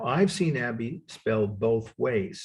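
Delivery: ordinary delivery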